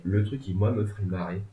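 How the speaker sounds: usual speaking voice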